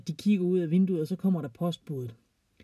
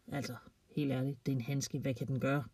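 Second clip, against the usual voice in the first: calm big dog; 5 semitones lower, 10 dB quieter than usual. A narrator's reading. Calm dog's thoughts, unbothered.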